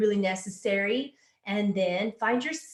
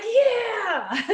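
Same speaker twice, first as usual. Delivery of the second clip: cheering crowd; 12 semitones higher, 5 dB louder than usual. Retold or imitated. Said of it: "And they're like".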